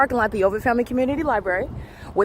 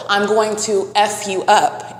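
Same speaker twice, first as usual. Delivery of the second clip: slow, deliberate mock threat; slow quoted threat; much slower than usual. Quoting the bathroom woman's threat to viewers, slowly. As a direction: spell out the threat word by word. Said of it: "and I quote".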